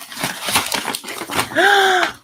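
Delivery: high, sharp gasp of delight; awed gasp. Real, not acted. Vlogger opening the handmade notebook, awed gasp. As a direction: gasp at a beautiful surprise.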